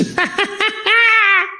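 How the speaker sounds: high, far-off cackling cry; squealing, near-crying mock laugh. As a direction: mimic the crowd cackling at him. Mocking the audience's laughter.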